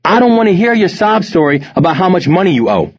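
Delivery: high-pitched, forceful telling-off; angry scolding. A narrator's reading. Mother confronting her drug-dealing son, angry scolding. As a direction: lay down the law, outraged.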